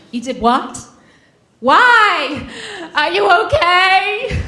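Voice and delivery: high, loud, slow alarm; mock frantic mother; worried mother. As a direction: panic like a scared mom. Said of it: "my mother's reaction".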